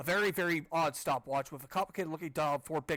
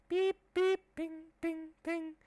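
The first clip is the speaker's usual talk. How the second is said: squeaky high machine beeps and pings; high beeping imitation; 14 semitones higher, much slower than usual. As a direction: chirp it like a little machine.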